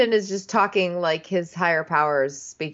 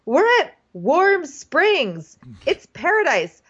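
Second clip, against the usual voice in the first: giddy mock guys; 8 semitones higher than usual. Host mocking the guys' hype, high sing-song gush. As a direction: gush like an overexcited tourist. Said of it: "and they go".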